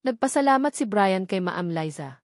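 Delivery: ordinary delivery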